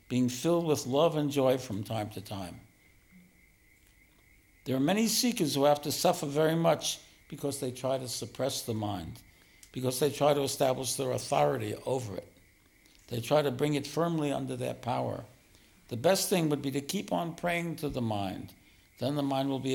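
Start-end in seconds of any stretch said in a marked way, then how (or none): none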